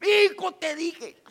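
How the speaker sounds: a loving father calling out to his stubborn son; God scolding. Retold or imitated. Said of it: "yo me imagino que Dios está ahí".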